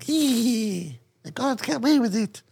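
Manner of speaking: high-pitched cartoon getaway voice; mock Spanish crook. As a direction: squeal it like a comic rogue. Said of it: "and he's like".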